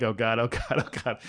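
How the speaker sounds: low, hushed mock panic, fading away; retold dread. Boy seeing the teacher coming out, hushed mock dread. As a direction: sink into a soft, doomed little repeat.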